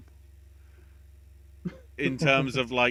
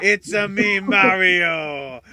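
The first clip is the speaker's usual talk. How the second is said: loud, high Italian Mario voice; Italian accent; 6 semitones higher, 13 dB louder, much slower than usual. Pretend. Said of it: "I do the Italian accent".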